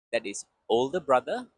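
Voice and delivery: ordinary talk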